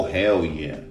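ordinary talk

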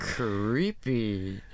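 low, very slow cartoon baddie; cartoon villain voice. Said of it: "The cartoon villain voice is funny".

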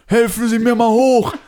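high, loud drawn-out yell; imitated bellowing demand. Homeless man yells at passer-by for help, imitated bellow. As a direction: bellow it like a stranded street drunk. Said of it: "Und brüllt mich halt so, so schon so laut an".

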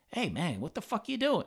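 imitated indignant challenge. Oscar confronting man peeing in courtyard, indignant. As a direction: pitch leaping up in mock indignation.

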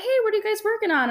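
breezy fake-casual hello to the group; chirpy high greeting, rushed; mock cheerful greeting. Cheerful greeting to teammates, retold.

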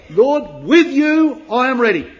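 loud, high stress on "with you"; "with you" thrown forward hard and loud; emphatic. Acted. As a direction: declare it boldly, punching "with you". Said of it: "the emphasis, he says".

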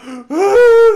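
high, loud imitated gasp; imitating the girlfriend gasping after the hit; mock shocked gasp. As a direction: gasp like she's been struck. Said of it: "she's all".

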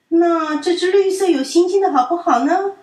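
hopeful last offer; coaxing child. A narrator's reading. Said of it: "淘淘问". (满怀希望地问)

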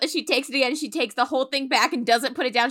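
ordinary talk

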